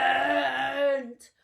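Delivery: long drawn-out shout for Elephant; loud slow call. Acted. Trapped lion calling his friend, a loud drawn-out shout. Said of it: "he roared so loud for Elephant".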